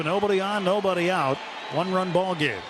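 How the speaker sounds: normal talking voice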